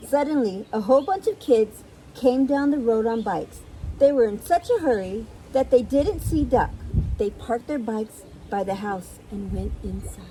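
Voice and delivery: animated picture-book reading; lively storyteller with swooping pitch; story narration. A narrator's reading. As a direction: read it to small children, bouncy and clear. Reads a picture book aloud.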